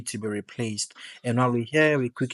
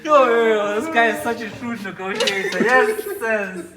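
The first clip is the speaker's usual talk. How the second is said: excited; 10 semitones higher, 8 dB louder than usual. Real, not acted. Hyped storytelling, laughing.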